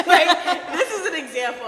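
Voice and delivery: real laugh breaking over the words; loud laughter. Real, not acted. Laughing at her own story.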